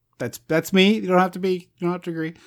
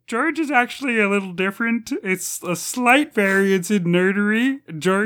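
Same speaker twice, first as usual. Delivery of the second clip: George Lucas; 5 semitones higher than usual. Acted. Podcaster defends his director impressions, high nerdy Kermit-like voice. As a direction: explain pedantically in a raised Kermit-ish voice. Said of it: "Why are you doing George Lucas".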